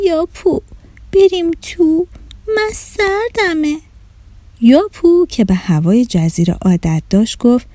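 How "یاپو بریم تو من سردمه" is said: moaning. A narrator's reading. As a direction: moan it out, cold and miserable. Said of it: "با ناله گفت".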